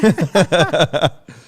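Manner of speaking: loud, open laugh at the joke; real burst of laughter. Real, not acted. Laughs at cohost's blunt joke.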